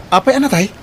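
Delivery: sudden loud, high gasped question; stunned cry of why; shocked question. Acted. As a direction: react in disbelief at the bad news. Shocked at news of death.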